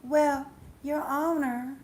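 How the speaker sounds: mock-earnest, drawn-out appeal; pleading mother in court. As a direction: draw it out like a mother addressing the court. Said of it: "you know what the mother says".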